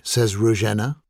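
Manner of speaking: ordinary delivery, nothing marked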